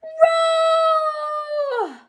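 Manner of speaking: a big high stretched-out roar; lion roar. A narrator's reading. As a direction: roar it out like a showy lion king. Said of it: "by how loud he could".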